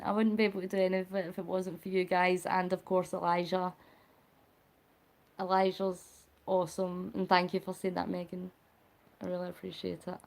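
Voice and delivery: low, soft, heartfelt gratitude; touched, sincere thanks. Real, not acted. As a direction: thank them softly, genuinely moved. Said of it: "you're gonna make me cry".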